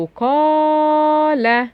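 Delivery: برتقالة slowly, with a long high held aa; long alif. Shown as a demonstration. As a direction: stretch the alif in برتقالة before the final syllable. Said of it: "فيها مد ألف". (مد الألف في برتقالة)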